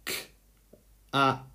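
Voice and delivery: K-A-T split into separate sounds; sounded out letter by letter. Shown as a demonstration. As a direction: sound out K-A-T for children. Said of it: "break it up".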